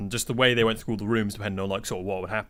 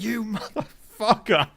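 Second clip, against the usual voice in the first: high, drawn-out mock outrage; hammed-up mock cursing; mock curse; 11 semitones higher, much slower than usual. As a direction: stretch out the insult, aghast. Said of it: "they were cursing me for so long".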